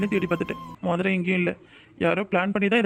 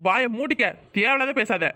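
much higher, heated shout; angry shout; 3 semitones higher, slower than usual. Acted. Groom angrily shouting down cousin Veerayi. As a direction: cut her off sharply, shut her up.

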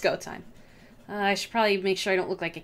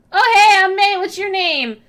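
loud high eager introduction; rehearsed greeting; 10 semitones higher, 10 dB louder than usual. Pretend. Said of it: "what are you gonna say?".

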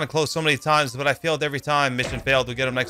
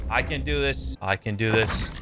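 ordinary talk, nothing marked

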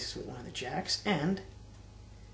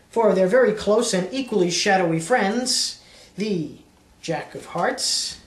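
showy magician's pitch; card magician patter; 2 semitones higher, 6 dB louder than usual. Acted. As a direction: sell the trick like a stage magician. Magician showing a card trick.

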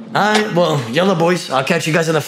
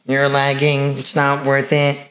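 slow words as if typing; message being typed; slower than usual. Real, not acted. Streamer sending a message to a lagging caller, slow dictation. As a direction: draw the words out while composing a message. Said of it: "I'm gonna send you a message".

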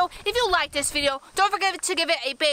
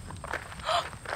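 gasp; 11 semitones higher, 9 dB quieter than usual. Real, not acted. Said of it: "Oh my gosh, guys!".

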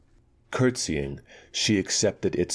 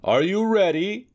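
high called question through the door; shout; 4 dB louder than usual. Acted. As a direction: call it loud to the room. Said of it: "shouted the cousin".

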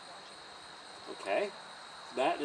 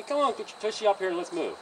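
bright, encouraging call to the horse; mildly upbeat come-on; upbeat coaxing; 6 semitones higher, 4 dB louder than usual. Shown as a demonstration. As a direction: coax him up cheerfully. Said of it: "But be positive, be upbeat about it".

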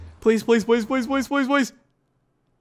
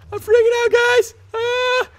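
much higher squeal of panic; freaking out; 4 dB louder, slower than usual. Real, not acted. Gamer to viewers as screen glitches, high panicked squeal.

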